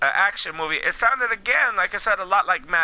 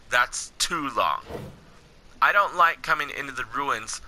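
low, measured wanderer's narration; dramatic story narration; 9 semitones lower, 4 dB quieter than usual. Acted. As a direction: read it as a weary survivor's account, lower than your chat. Reads game's wanderer narration aloud.

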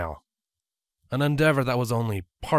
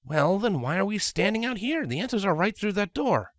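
put-on slightly higher, brisk young dragon voice; mildly raised, quick-talking dragon; Spike's voice; 9 semitones higher than usual. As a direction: voice Spike, a bit exasperated.

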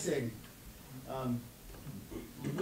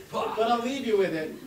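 usual speaking voice

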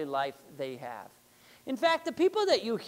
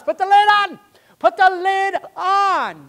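parent's yell; 10 semitones higher, 15 dB louder than usual. Acted. Telling every child in the house after the blender mess, shouted.

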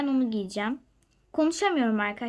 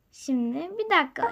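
normal talking voice

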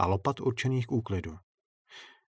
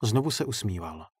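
ordinary delivery

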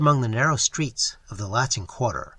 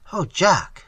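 higher, louder cry of alarm; 5 semitones higher, 5 dB louder than usual. A narrator's reading. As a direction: cry out to him in fright. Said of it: "she cried".

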